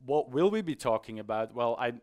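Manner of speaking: ordinary delivery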